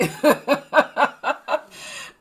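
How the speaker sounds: loud, high burst of laughter; mother laughing at her Mack truck question; bright laugh. Real, not acted. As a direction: laugh out at your own bluntness.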